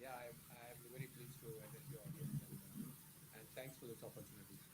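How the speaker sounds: faint, distant voice far from the mic; very low volume, like a bad connection; barely audible. Real, not acted. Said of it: "your voice is very low".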